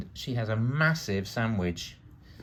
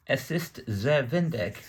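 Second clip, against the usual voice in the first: put-on slow, higher German accent; 5 semitones higher, slower than usual. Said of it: "My wonderful German accent there".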